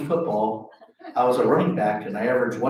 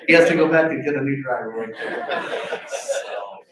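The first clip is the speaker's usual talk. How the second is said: laughing; 4 dB louder than usual. Real, not acted. End of story, laughing.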